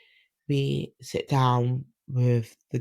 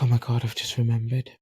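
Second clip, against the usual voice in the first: sudden realisation; faster than usual. Retold or imitated. Sudden memory, voiced.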